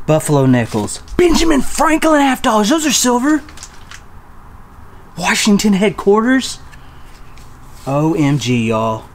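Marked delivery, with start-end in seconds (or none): 1.2-6.6 much higher, louder, thrilled listing; excited. Real, not acted. Vlogger names silver coins in the chest, high thrilled listing. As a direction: rattle them off in delight.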